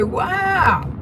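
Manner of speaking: imitating amazed neighbors, high and drawn out; a big, slow mock-amazed "wow"; shocked admiration. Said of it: "they be so shocked".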